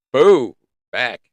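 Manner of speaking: loud jeering boo back; mock boo. Host loudly boos winter away. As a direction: jeer at winter, shoo it back. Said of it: "I say to winter".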